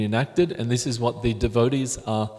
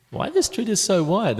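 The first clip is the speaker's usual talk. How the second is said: puzzled wondering; 9 semitones higher than usual. Retold or imitated. Imagined puzzled visitor's thought.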